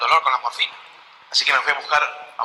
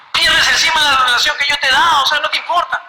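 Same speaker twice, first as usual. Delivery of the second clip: higher, louder hurt complaint; indignant reproach; 9 semitones higher, 4 dB louder than usual. Retold or imitated. Brother reproaching a priest friend, indignant. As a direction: scold the friend who let him down.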